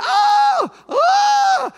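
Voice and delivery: loud high cries, one per spin; imitated screams. Preacher reenacts the lady's loud panicked cries. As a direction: yelp out each time she spins under. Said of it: "all you hear is screaming".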